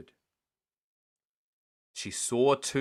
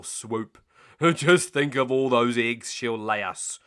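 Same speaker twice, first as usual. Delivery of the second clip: louder gruff scheming; scheming crook; 7 dB louder than usual. Acted. Hugh to Len, louder scheming crook. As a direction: plot it with relish.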